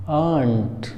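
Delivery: आंट as one drawn-out "aant"; slow corrected आंट in one syllable; silent r, long vowel. Shown as a demonstration. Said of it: "इसको पढ़ेंगे आंट".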